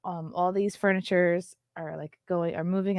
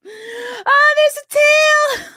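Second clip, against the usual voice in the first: very high, loud, slow squeal; very high loud slow squeal of delight; 11 dB louder, much slower than usual. Real, not acted. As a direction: squeal at the little tail. Discovers tail on romper.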